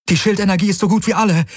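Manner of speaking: high, fast urgent call; a quick alarmed cry from the cockpit. Acted. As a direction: blurt the warning fast and high. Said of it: "rief Kirai".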